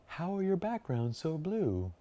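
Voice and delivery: high, slow, wide-eyed client question; amazed client asking, put-on voice; mock client voice. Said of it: "I just say it like that".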